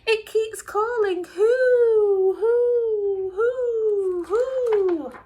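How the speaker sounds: high, loud panicky little voice; frightened cry. Acted. Small mouse crying out in fright about a ghost. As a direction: cry out in fright to your brother. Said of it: "feeling very frightened, he cried".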